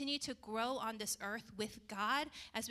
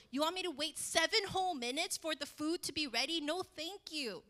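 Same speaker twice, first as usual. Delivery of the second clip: indignant; 5 semitones higher, 5 dB louder than usual. Retold or imitated. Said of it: "Microwave sometimes takes too long".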